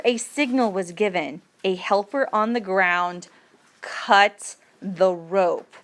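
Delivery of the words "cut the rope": ordinary talk, nothing marked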